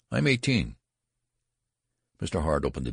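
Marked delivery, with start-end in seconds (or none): 0.1-0.8 higher, louder insistence; insistent cry. A narrator's reading. Benjamin insisting to the registrar, raised voice. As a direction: plead it out, insisting.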